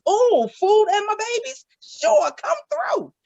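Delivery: thrilled grandma squealing yes; delighted mom. Retold or imitated. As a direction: gush with delight at food and grandbabies.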